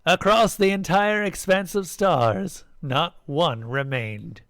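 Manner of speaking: high start, slow solemn fall; priest intoning. A narrator's reading. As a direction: proclaim it grandly, then slow right down. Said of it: "the priest intoned".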